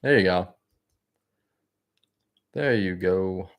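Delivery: talking while chewing gum; words round a wad of gum. Real, not acted. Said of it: "sorry for the fucking chewing".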